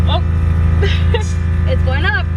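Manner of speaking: high, loud delighted laugh; thrilled giggle as it works; excited. Real, not acted. Tank fills, she's thrilled.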